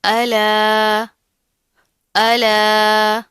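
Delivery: "अला" loud and very slow, vowel held long; stretched madd. Shown as a demonstration. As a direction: chant "अला", pulling the vowel out. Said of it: "खींच कर पढ़ेंगे".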